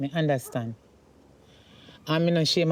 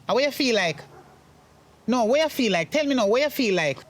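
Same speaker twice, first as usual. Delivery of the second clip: indignant mother, pitched up; heated, higher-pitched telling-off; angry scolding; 4 semitones higher than usual. Acted. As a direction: scold him and demand an answer. Mother angrily scolds son.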